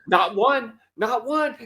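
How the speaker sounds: high, emphatic retold indignation; mock outraged mom. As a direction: imitate a mother counting the offences. Voices mother unwrapping candy.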